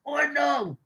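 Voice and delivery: high, loud first Ordnung then dropping; mock old man yell. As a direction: bark it like a grumpy grandpa at a parked car. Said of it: "And you go".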